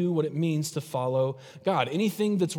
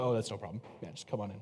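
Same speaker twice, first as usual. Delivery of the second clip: much softer, lower breezy reply; mock easygoing God; 6 semitones lower, 10 dB quieter, faster than usual. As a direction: play God waving it off sarcastically. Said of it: "And he's go".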